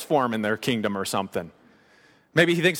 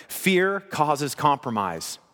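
slow emphatic statement; much slower than usual. Shown as a demonstration. Key sermon line, slow.

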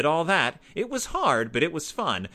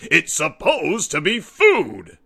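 a big louder fatherly roar; 5 semitones higher, 8 dB louder, slower than usual. A narrator's reading. Papa roaring at Brother over dinner, loud and heavy. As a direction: bellow it down at the cubs. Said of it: "roared Papa".

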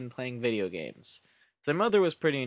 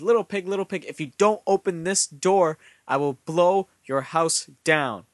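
loud, raised storybook threat; annoyed wolf; 4 semitones higher, 6 dB louder than usual. A narrator's reading. Annoyed wolf threatening pigs through the door, loud and annoyed. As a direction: hammer out the warning, slowing at the end. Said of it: "the wolf's so annoyed by the statement".

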